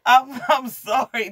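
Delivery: high, loud, slow words through laughter; sorry, still cracking up; real laugh through the apology. Real, not acted.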